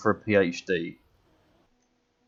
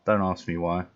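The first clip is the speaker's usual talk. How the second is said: ordinary talk, nothing marked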